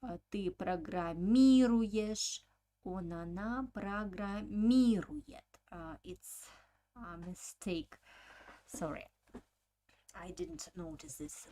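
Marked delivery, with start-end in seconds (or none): 0.3-5.4 long МИ in программируешь and программирует; teacher's emphatic И in программируешь, программирует; stress on И. Shown as a demonstration. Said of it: "on the letter E".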